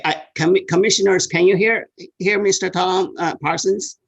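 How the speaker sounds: loud, high, halting call to the room. Real, not acted. Asking commissioners whether they hear the caller, calling out loudly. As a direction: project so everyone can hear you.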